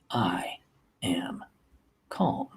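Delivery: very soft, slow, low affirmation; calm self-talk. Shown as a demonstration. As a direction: breathe out and settle into it. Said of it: "take a deep breath, and".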